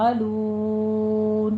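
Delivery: یعملون with the final loon drawn very slowly; long madd. Shown as a demonstration. Teacher reciting یعملون with the pause madd. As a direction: stretch the لون in یعملون for six counts. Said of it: "لون میں مد آرس وقفی".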